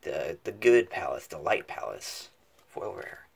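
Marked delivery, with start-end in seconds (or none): none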